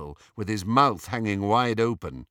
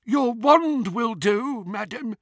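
high, slow goblin teller; 12 semitones higher, 5 dB louder, slower than usual. A narrator's reading. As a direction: draw it out high, softening on the last word. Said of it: "said the goblin".

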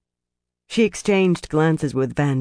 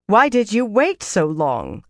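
higher, slow, reproachful demand; 5 semitones higher than usual. A narrator's reading. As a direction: demand an answer from slow accomplices. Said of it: "Asni demanded of Phalion and Marilyn".

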